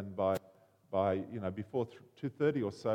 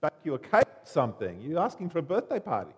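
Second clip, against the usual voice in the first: nasty retort; 5 semitones higher, 6 dB louder, faster than usual. Retold or imitated. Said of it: "her friend was quite nasty".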